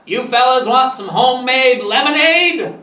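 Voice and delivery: shouting over. A narrator's reading. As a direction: call the offer across the lawn. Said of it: "he shouted over at us".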